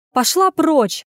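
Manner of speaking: high, loud dismissal; angry bark. A narrator's reading. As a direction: bark at someone to get away. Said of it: "рявкнула на неё Аня".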